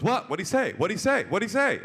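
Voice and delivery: high, loud repeated question; insistent demand. Retold or imitated. Cop demanding translation from interpreter, agitated insistence. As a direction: press urgently for an answer.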